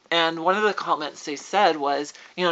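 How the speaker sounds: ordinary delivery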